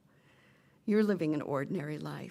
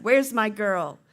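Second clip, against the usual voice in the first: loud fatherly call from outside; dad calling out; 3 semitones higher, 9 dB louder than usual. Acted. Dad outside looking for his girl, loud calling out. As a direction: sing out for your daughter, much louder. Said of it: "dad say from outside".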